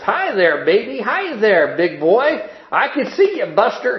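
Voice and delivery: put-on high, loud sing-song drunk greeting; drunk old man. A narrator's reading. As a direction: call out like a tipsy old man.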